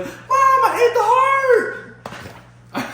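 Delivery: loud, high, drawn-out laughing yell; excited laughing shout. Retold or imitated. Retelling shouting to mom.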